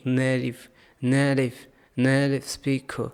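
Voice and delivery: teacher shows the flap; flap t in native. Shown as a demonstration. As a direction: say native with a quick tapped d in the middle. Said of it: "इस टी को हम flap डी बना देंगे".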